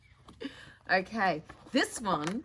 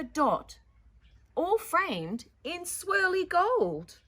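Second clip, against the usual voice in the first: put-on louder, expressive read-aloud to children; story narration; 7 semitones higher, 6 dB louder than usual.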